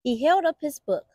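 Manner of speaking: ordinary delivery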